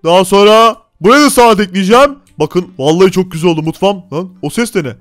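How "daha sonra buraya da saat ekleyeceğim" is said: ordinary talk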